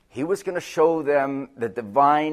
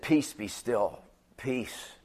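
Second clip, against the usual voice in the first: calm rebuke; 3 semitones lower, 9 dB quieter, slower than usual. Acted. Voicing Jesus calming the storm.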